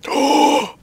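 startled gasp; sudden shocked intake of breath; sharp gasp. Real, not acted. Startled by enemy.

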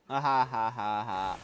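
loud, slow ha-ha laugh; gloating laugh. Real, not acted. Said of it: "Sorry for laughing".

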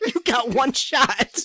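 high, slow, laughing voiced quote; a lightly put-on dramatic warning; imitating himself, laughing; mock announcer. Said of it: "I was like".

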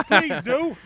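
usual speaking voice

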